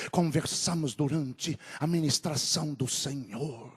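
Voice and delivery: ordinary talk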